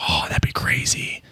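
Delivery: hushed whisper to himself. Real, not acted. Mulling his Marith deck to himself, in a hushed whisper. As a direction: breathe it out under his breath like a secret thrill.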